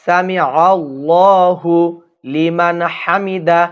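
সামি আল্লাহু লিমান হামিদা slow, আল্লাহু stretched, no break before লিমান; correct recitation. Shown as a demonstration. Teacher models the correct prayer phrase for viewers, slow recitation. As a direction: intone it slowly with a held আল্লাহু. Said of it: "আমি যেভাবে বলবো ইনশাআল্লাহ এইভাবে বলবেন".

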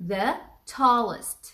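"the tallest" drawn out very slowly, word by word; teacher stretching "the tallest" at x0.3; slow model phrase. Shown as a demonstration. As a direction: spell it out slowly for the class. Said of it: "عشان احنا بنقول ال".

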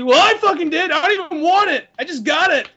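high, loud smug boast; bragging taunt. Acted. Adult gloating to kid at Walmart, loud bragging. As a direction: show off loudly to make someone jealous.

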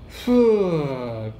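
slow drawn-out breath blown out like smoke; long exhale of smoke. Shown as a demonstration. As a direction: let the last puff go with one long exhale. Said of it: "てこうやった瞬間に".

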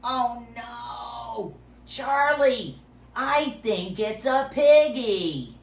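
high, drawn-out pretend wail; loud mock alarm. Host telling children what's in the box, loud mock alarm. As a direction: cry out big and high in play panic.

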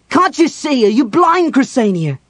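furious, very high shout; losing control. A narrator's reading. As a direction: shout in exasperated desperation. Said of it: "Completely losing control of himself".

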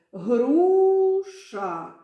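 "Груша" with a long, high, loud "у"; drawn-out stressed vowel. Shown as a demonstration. Teacher repeats the stressed syllable for pupils, drawn-out vowel in "Груша". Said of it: "давайте повторимо".

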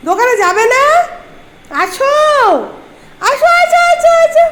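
very high, loud coaxing calls; high calling. Pretend. Mother luring her pet, very high loud call. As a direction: sing out to the pet to come.

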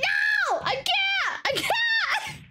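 high, overwhelmed wailing; panicked wail. Real, not acted. Player overwhelmed by the game, high panicked wail.

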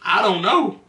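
high, played-dumb denial; mock innocent shrug; feigned innocence. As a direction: act totally clueless about the torn couch. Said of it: "I was like".

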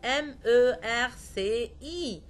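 "إم أو آر سي إي" as separate French letter names, loud; loud teacher's spelling of merci; spelled out. Shown as a demonstration. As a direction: spell it letter by letter, loudly, for a class. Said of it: "تهجى مثلًا كلمة ميرسي".

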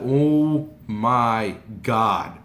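loud, very drawn-out exclamation; overwhelmed awe. Retold or imitated. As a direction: stretch each word in stunned nerves. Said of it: "never been so nervous".

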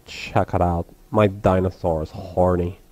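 very low, halting dopey voice; low lunkhead delivery with chuckles; dim sniggering dude. Acted. Rex shows Weevil his dinosaur, low dim voice. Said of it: "These guys sound awfully familiar".

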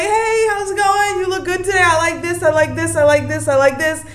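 high, bright sing-song hello to a friend; playful sing-song chat with the deity, laughing at the end; gushing greeting. Retold or imitated. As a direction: gush like greeting a best friend, half singing.

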